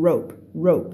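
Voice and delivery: rope rope said clearly; teacher showing how rope sounds; pronunciation model. Shown as a demonstration. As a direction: model the word rope for students. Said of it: "คำนี้เสียงว่า".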